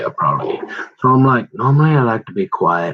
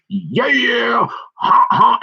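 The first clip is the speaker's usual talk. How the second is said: excited cheer; 13 semitones higher, 3 dB louder, much slower than usual. Retold or imitated. Excited kid at the races.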